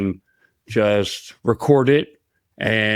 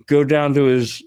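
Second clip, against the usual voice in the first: usual speaking voice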